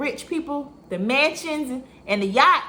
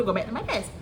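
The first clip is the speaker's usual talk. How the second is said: ordinary talk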